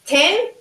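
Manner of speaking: ten with a puff of air; "Ten," with a breathy, exploding t; strong burst on the t of "Ten,"; aspirated ten. Shown as a demonstration. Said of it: "No hay esa explosión".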